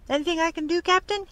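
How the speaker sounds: high-pitched question to the captain; young sailor. A narrator's reading. Young sailor offers the captain help.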